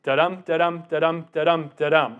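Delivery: five even beats, each "dum" stressed after a light "da"; metered "da-dum" demo, stress on every second syllable; iambic rhythm. Shown as a demonstration. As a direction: chant "da-dum" in steady weak-strong pulses. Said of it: "taking a deep breath".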